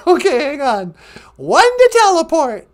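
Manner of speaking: put-on high, loud old lady voice; grandma character voice. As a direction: chirp it like a sweet old granny. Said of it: "Donna Bonjour".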